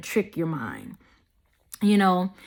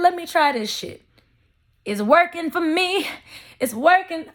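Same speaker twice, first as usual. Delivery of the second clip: excited; 8 semitones higher than usual. Retold or imitated. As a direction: let the joy rise as you say it. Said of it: "I said".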